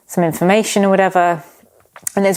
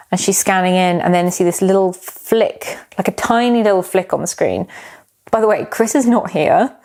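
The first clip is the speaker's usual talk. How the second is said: normal talking voice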